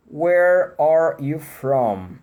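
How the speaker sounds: very slow Where are you from?, R sounds stretched and curled; exaggerated English R. Shown as a demonstration. Teacher asking the class the question, very slow R demo. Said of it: "Responde aí".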